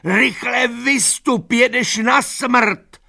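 very high, slow, desperate pleading; old mare begging Boxer to escape; frantic screaming. A narrator's reading. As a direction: scream at him to get out.

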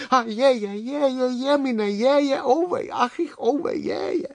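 high-pitched woeful oh-dear wail; wailing lament. A narrator's reading. Inchworms lament their lost ball of yarn, wailing. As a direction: wail in mock despair, high and drawn into a chain. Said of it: "Píďalky spustily nářek".

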